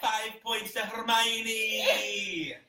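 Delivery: loud laughing cheer; gleeful shout. Real, not acted. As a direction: shout it out like a proud quiz host.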